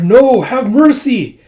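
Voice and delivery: slow high pleading cry. Retold or imitated. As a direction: beg desperately. Begging prisoners.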